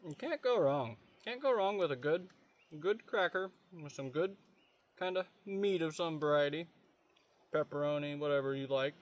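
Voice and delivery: talking with mouth full; chewing while talking, mouth full. Real, not acted. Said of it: "Excuse me for talking with my mouth full".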